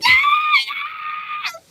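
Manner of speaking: high-pitched mock yell of a kid; loud high squeal; high mock wail. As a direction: shriek like a kid watching his bike crushed. Kid screaming as bike crushed.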